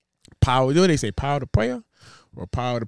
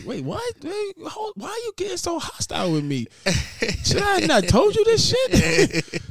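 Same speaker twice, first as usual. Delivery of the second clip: mock offended self. Retelling a text fight with a friend, hammed-up squeaky disbelief.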